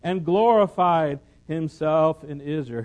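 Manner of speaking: loud, high proclamation of scripture; preaching at full force. Acted. Preacher calling the mountains to sing, loud and high. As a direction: ring it out like a prophet calling the hills.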